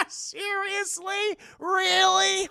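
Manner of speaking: high, drawn-out incredulous questions; delighted disbelief. Retold or imitated. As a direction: act out gleeful amazement. Chucky delighted by the news.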